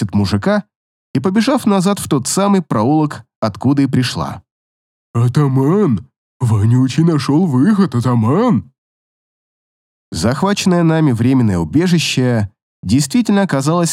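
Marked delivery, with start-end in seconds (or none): 5.1-8.7 low rough creature call; monster calling. Acted. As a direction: call out to the chief. Monster calls the chief.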